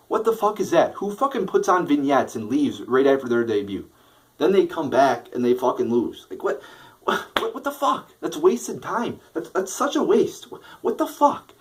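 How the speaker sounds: fed-up fan venting at a pointless booking, pitch soaring; exasperated disbelief. Real, not acted. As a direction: voice shooting up high in disbelief. Ranting about a wasted tag team.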